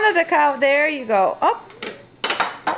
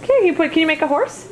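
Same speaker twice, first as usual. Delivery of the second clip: put-on bright coaxing toddler talk; reading to a toddler; 2 semitones higher, 3 dB quieter than usual. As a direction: coax a little child gently.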